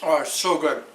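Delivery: talking while chewing food; words round a bite of food; mouth full. Real, not acted. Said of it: "I wanna talk with my mouth full".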